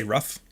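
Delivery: "rough" for roof, short vowel; American "rough", clipped vowel in roof; American pronunciation. Shown as a demonstration. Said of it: "a lot of Americans say rough".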